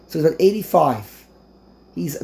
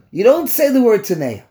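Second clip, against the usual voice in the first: raised-pitch correction; emphatic rebuke; 5 semitones higher, 4 dB louder than usual. Real, not acted. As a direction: correct them firmly, voice lifted. Scolding rebuttal of blunt wording.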